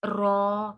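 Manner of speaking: रॉ with a full rounded r, held long; heavy ra. Shown as a demonstration. Teacher showing the heavy letter, रॉ full and long. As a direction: draw out a thick, heavy रॉ. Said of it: "मोटा होगा ये वाला रॉ".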